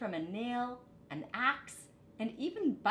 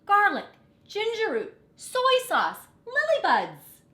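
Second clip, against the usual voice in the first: much higher, much louder joyful shouting; excited shout; 13 semitones higher, 12 dB louder than usual. A narrator's reading. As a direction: call out each item at the top of your voice. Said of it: "people cried out".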